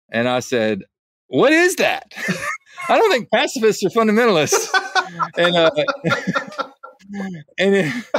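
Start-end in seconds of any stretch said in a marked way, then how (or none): 1.3-2.1 high-pitched, baffled question; retold reply of amused bafflement; incredulous retort. Quoting own baffled online reply. 2.5-8.0 high-pitched, through real laughter; amused, laugh breaking into the words. Real, not acted. Laughing over own retold reply.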